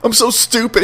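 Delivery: high-pitched self-reproach; self-berating. Retold or imitated. Movie fan acts out the character calling himself stupid, self-berating. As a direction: imitate someone beating himself up. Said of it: "he just keeps calling himself an idiot".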